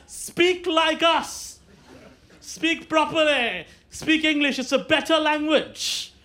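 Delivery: slow, high-pitched lecturing; imperious coloniser. Acted. British colonisers telling Indians to speak English, mock imperious. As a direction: spell it out slowly, as if to pupils.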